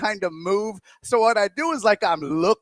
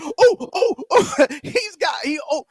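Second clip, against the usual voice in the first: bursting to tell; 8 semitones higher than usual. Pretend. Shopper bursting to tell on the salsa thief, excited and stammering. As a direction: nearly blurt it all out, all flustered. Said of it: "I want so bad to be like".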